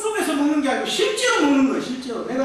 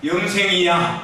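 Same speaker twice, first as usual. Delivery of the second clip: call for repetition; 5 semitones lower, slower than usual. Shown as a demonstration. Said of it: "한번 따라해 봅시다".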